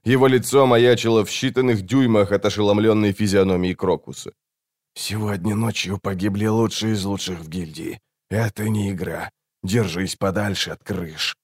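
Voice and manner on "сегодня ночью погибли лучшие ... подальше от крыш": soft, restrained warning; hushed assassin. A narrator's reading. Assassin secretly warns a boy in the alley, hushed. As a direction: keep the voice down, grave and secretive.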